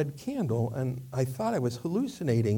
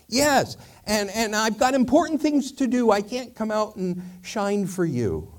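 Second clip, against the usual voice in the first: higher-pitched self-important candle; second candle; 8 semitones higher than usual. Acted. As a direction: excuse yourself in a raised candle voice. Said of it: "it says".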